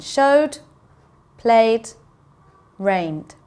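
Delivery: showed, played, rained, each closing on a voiced D; ED as a simple D in showed, played, rained; D sound at the end. Shown as a demonstration. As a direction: say Showed, played, rained as separate one-syllable words. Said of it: "there's a D sound at the end".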